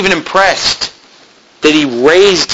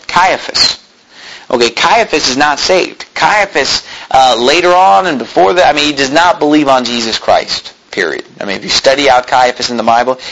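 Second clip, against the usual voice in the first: ordinary delivery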